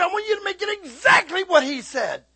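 high, loud insistence; hammed-up customer laying down the order; mock demanding customer. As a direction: imitate someone forcefully demanding it exactly so.

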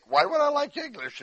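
high, puzzled self-question; wondering aloud. Retold or imitated. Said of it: "I think".

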